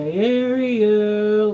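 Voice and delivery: long, high, loud held cry; belted, drawn-out exclamation; drawn-out call. Acted. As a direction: stretch the name out loud and high.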